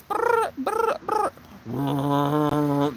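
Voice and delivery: high trilled horn blasts, then a drone; imitated war horn. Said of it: "the war horn".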